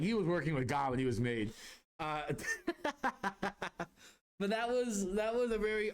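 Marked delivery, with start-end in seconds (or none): 2.3-3.8 long, high rolling chuckle; real laugh. Real, not acted. Storyteller amused by his own tale, laughing. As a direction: laugh helplessly at your own joke.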